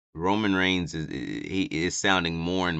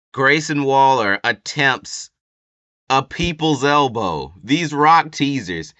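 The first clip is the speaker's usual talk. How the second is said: louder, higher and slowed with amazement; excited disbelief; 7 semitones higher, 8 dB louder, slower than usual. Real, not acted. Fan recapping the match for viewers, excited disbelief. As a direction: tell it like you can't believe it happened.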